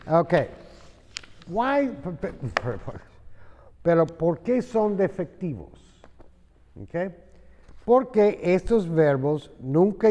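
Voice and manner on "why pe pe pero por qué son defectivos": ordinary talk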